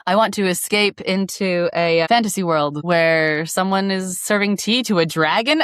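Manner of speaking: ordinary talk, nothing marked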